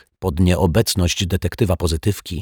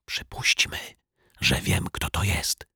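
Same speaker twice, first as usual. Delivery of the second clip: very soft, conspiratorial whisper; 8 dB quieter than usual. A narrator's reading. Detective confides his suspicion to Mr Mietek, whispering. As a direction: drop to a secretive hush. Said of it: "szepnął detektyw Pozytywka".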